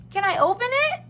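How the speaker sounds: high, eager cub voice; Baby Bear. A narrator's reading. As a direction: ask eagerly like a little one. Baby Bear asks about present.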